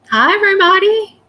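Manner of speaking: high, loud sing-out hello; big high-pitched hello to a crowd; bright greeting call. Real, not acted. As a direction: call out cheerily to the whole audience. Greets the live viewers.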